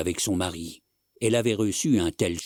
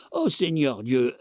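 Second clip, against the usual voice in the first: slow, higher groan between coughs; coughing fit; 6 semitones higher, slower than usual. Acted. Old man lamenting as he coughs, wheezy coughing fit. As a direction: drag it out amid an acted coughing fit. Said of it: "qui avait enfin cessé de tousser".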